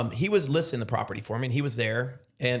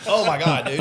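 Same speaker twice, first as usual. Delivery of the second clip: shocked; 3 semitones higher, 7 dB louder than usual. Retold or imitated. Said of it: "And I'm like".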